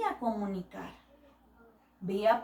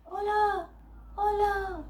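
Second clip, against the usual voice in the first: higher, short falling echo of the call; 9 semitones higher, slower than usual. Acted. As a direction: play the far-off echo answering back. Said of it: "su misma voz allá en el eco".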